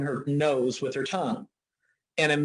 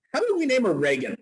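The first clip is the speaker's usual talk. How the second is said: quick, higher mock voice of his wife; imitating his wife; 8 semitones higher, 3 dB louder, faster than usual. Retelling his wife's naming suggestion, quick and higher. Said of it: "her mom goes".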